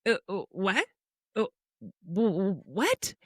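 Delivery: stammering, stunned what; retold bafflement. Mom reads son's dinner text, stammering bafflement. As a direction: sputter in mock disbelief, with gaps. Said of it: "in my mind, I'm thinking".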